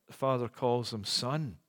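ordinary delivery

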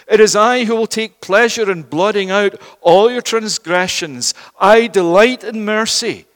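loud, emphatic sermon delivery; impassioned preaching. Real, not acted. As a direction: preach it out with weight and conviction. Preaches at full force.

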